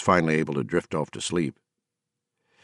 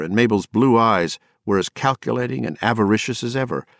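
ordinary delivery, nothing marked

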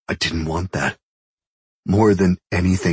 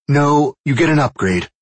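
correcting; 9 semitones higher, 5 dB louder than usual. A narrator's reading. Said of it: "she corrected".

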